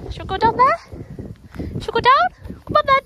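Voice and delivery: high, loud sing-song to the dog; dog talk. Real, not acted. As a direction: pitch up to get the dog moving. Sweet-talking the dog.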